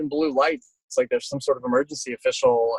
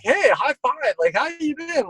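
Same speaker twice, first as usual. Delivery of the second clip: much higher, cheery hello; excited greeting; 13 semitones higher than usual. Retold or imitated. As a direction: pitch way up like a happy reunion. Retold greeting between old chasers.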